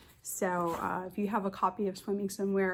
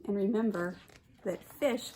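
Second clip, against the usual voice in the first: ordinary delivery, nothing marked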